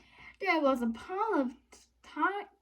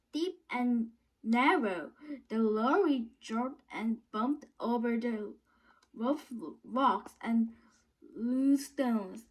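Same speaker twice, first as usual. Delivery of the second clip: plain reading voice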